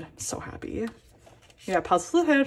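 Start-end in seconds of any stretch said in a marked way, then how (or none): 0.0-0.9 soft whispered joy; happy whisper. Real, not acted. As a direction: whisper it, overwhelmed. First signed SF9 item.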